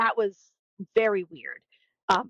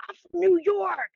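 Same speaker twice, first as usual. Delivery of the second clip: mock proud guy; 9 semitones higher than usual. Quoting audience guy.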